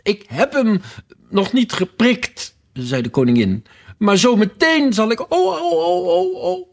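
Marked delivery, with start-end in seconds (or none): 0.0-2.6 distressed wailing. A narrator's reading. The queen answers Alice about her finger, wailing. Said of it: "kreunde de arme koningin het uit". 4.0-6.7 high, loud build into squealed "oh"s; distressed shrieking. A narrator's reading. The queen foresees pricking her finger, shrieking. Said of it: "kreunde de arme koningin het uit".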